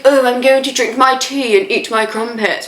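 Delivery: hammed-up posh English accent, lightly done; mocking how Americans fake a posh English accent. She mocks Americans faking posh English, in a hammed-up posh English accent. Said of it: "mimic our accents".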